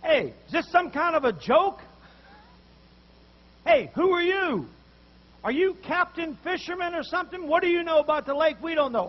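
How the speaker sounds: affronted professional fisherman, loud and high; indignant protest. Retold or imitated. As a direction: bristle at a stranger giving orders. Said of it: "he'd probably say".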